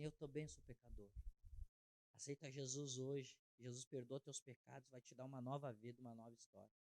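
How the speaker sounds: soft, low-key invitation; quiet pastor comforting a penitent; gentle pastoral reassurance. Retold or imitated. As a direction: reassure softly, letting each phrase settle. Pastor gently answering the sinner.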